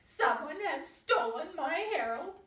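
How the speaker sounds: panicked mother crying out; frantic shout. A narrator's reading. Missus Duck calling across the pond, frantic shout. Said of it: "she shouted".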